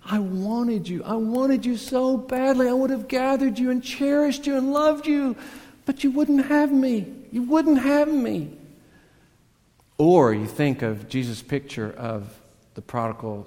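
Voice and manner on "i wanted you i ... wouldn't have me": impassioned pleading lament. Retold or imitated. Jesus to the city that rejected him, anguished pleading. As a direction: cry it out like a rejected lover. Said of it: "he's just totally showing his heart".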